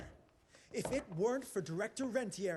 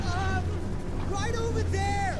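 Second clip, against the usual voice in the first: high, loud call across the room; 9 semitones higher, 9 dB louder than usual. Acted. Shouting over the machinery to his partner, loud. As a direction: yell it over the noise of the machine. Action scene, calling out.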